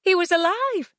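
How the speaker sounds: high, elated rush of hope; overjoyed, excited narration; joyful relief. A narrator's reading. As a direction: pitch soaring with happy disbelief. Believes lover is alive.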